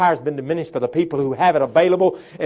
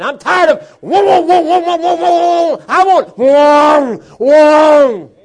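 stalling engine whoa; 13 semitones higher, 11 dB louder, much slower than usual. Retold or imitated.

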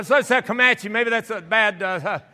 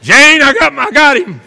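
long jungle-man yell, then shouted boast; Tarzan; 13 dB louder, much slower than usual. Retold or imitated. Man boasting to Jane after killing rat, triumphant bellow. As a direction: bellow a victorious jungle call to your mate. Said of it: "I felt like Tarzan".

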